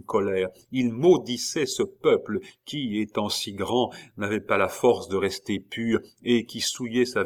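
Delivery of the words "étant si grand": ordinary delivery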